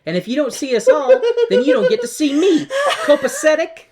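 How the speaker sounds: high, laughing sassy demand; sassy Dorothy. Retold or imitated. Dorothy demanding the Wiz see her friends, high and giggling. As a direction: play Dorothy full of attitude, cracking up. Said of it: "Dorothy speaks into it and is like".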